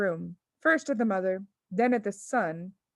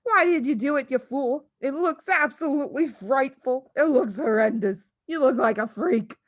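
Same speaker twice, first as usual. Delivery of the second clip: high, louder scolding; scolding shriek; 4 semitones higher, 5 dB louder than usual. A narrator's reading. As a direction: berate the fool husband. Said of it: "shrieked the mother".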